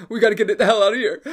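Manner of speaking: mock panic, hurrying to get out; scared friend. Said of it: "and he's scared".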